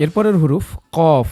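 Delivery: কফ said slowly from the tongue root; slow qaf demo. Shown as a demonstration. Teacher introduces কফ slowly. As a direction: drag কফ from the back of the tongue. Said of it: "জিবার গোড়া তার বরাবর ওপরের তালুর সঙ্গে".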